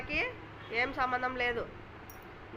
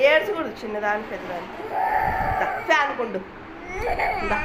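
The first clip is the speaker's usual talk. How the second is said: loud hushing; 2 semitones higher, 7 dB louder than usual. Real, not acted. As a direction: call him over loudly to calm him. Mother loudly hushing crying child. (ఏడుపు ఆపమని గట్టిగా చెబుతూ)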